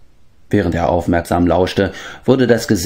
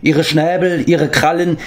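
rising, pitched higher, a grave proclamation; swelling thunderous voice; 8 semitones higher than usual. A narrator's reading. Medwin sends the animals a call to war, swelling thunderously. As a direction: let the voice swell like rolling thunder. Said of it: "seine tiefe Stimme schwoll an wie dumpfes Donnergrollen".

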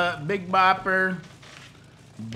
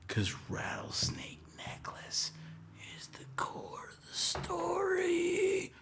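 slow breathy whisper of a big secret; dramatic whisper; 11 dB quieter, slower than usual. Acted. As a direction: hush it like a big reveal. Dramatic reveal of story core.

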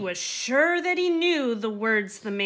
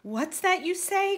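much higher startled cry; crying with dread; 10 semitones higher than usual. Acted. Startled donkey hearing he must carry the man, high dread. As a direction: jolt up in alarm. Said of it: "cried the donkey with dread".

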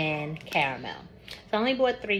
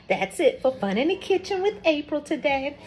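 higher, announcer-style outro; show sign-off; 9 semitones higher, 3 dB louder than usual. Acted.

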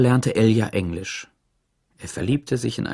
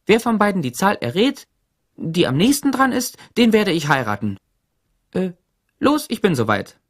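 put-on higher, dithering young woman; a flustered girl thinking aloud; Mira's voice; 10 semitones higher, 3 dB louder than usual. Mira muses aloud to her father, in a higher flustered voice.

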